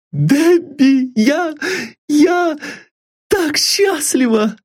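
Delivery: very high, breathless with happiness; a girl stammering out her joy; overjoyed young girl. A narrator's reading. Sylvia overjoyed.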